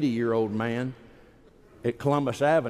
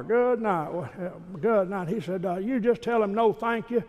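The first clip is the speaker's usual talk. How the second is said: higher, lightly voiced old father; dad's voice; 8 semitones higher than usual. Preacher voicing his dad on the phone, higher pitched. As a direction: play the father, brisk and amused. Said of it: "I called my dad back and said".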